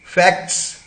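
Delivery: "फैक्ट्स" with k, t and s sounded; full-cluster pronunciation. Shown as a demonstration. Teacher giving the next example word, careful pronunciation. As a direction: articulate every final consonant of "फैक्ट्स". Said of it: "ट गया है इसमें से".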